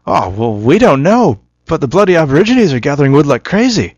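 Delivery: loud, high mock Aussie accent; Australian accent. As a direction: deliver the punchline in a broad Australian accent. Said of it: "I can't actually remember my Australian accent".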